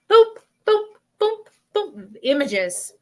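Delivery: short, high little boops; popping boop sounds. Retold or imitated. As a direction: pop out quick boops like flashes. Imitates images popping up.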